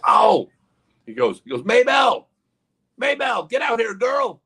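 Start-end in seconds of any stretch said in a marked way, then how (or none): 0.0-0.5 loud, high "oh" of realization; sudden realization. Retold or imitated. Guy suddenly remembers his sign. 1.6-4.4 put-on high, hollered Southern drawl, calling a girl; Southern accent. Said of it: "they had a Southern accent".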